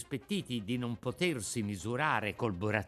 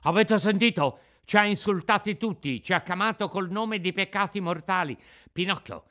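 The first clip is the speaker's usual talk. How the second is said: put-on higher, louder outraged boy voice; mild mock outrage of a gang; indignant schoolboys; 6 semitones higher, 6 dB louder than usual. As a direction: demand an apology, fuming. Boys outraged at the insult.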